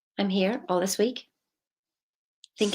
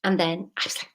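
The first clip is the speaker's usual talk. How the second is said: ordinary talk, nothing marked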